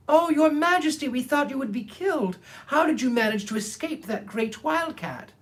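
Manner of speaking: high, squeaky mouse chorus; little mice rushing to their queen in relief; exclaiming mice. Acted. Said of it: "they exclaimed".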